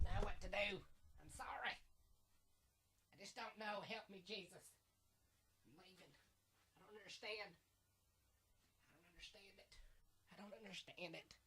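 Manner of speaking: fading off as he walks away from the mic; muttering further and further from the mic; walking away from mic. Real, not acted. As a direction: voice drifting off into the distance. I'm leaving.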